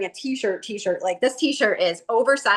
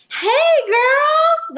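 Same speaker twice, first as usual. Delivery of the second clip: very high, loud, bright-sing-song greeting voice; Monique's greeting; 7 dB louder than usual. Retold or imitated. Imitating the dance teacher greeting her in a very high loud voice. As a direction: squeal it high like a gushing friend. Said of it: "Monique's like".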